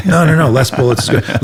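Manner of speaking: mock sarcastic reply. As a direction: play the fool who thinks fewer bullets is better. Mocking absurd idea.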